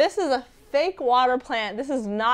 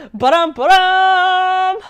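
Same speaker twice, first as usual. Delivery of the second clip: high, loud, slow ba-dum fanfare; sung drumroll; 10 semitones higher, 13 dB louder, slower than usual. Pretend. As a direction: belt out a silly drum sting. Sung drumroll after topic intro.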